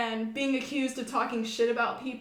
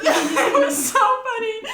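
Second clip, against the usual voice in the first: high, loud laugh through the words; 11 semitones higher, 11 dB louder, slower than usual. Real, not acted. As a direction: burst out laughing, slower. Laughs recalling the scene.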